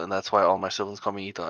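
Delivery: usual speaking voice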